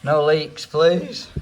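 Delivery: loud, high desperate plea; pleading prayer. Real, not acted. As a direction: beg the heavens, loud and high. Begging before testing toilet.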